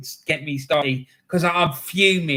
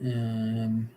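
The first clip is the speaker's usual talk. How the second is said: counting to himself; 7 semitones lower, 8 dB quieter, slower than usual. Real, not acted. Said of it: "let me count how many I've seen".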